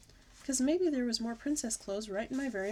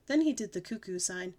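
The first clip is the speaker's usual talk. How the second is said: ordinary delivery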